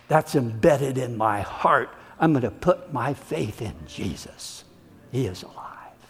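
quiet sincere vow fading to a whisper; heartfelt believer. Acted. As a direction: fade from tender confession to a hushed whisper.

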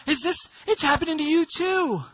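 high, astonished discovery; amazed realization. Acted. Healed lepers looking at each other, amazed. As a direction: blurt it out in mounting wonder.

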